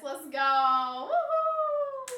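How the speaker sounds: high, loud woohoo; bursting, high-pitched celebration; excited cheer. Real, not acted. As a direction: cheer it out like a win. Celebrating a sub.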